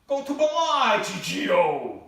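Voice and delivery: drawn-out loud mocking call; hammed-up slow yell; mock shout. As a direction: bellow it slowly like it's obvious. Mocking a confused resident.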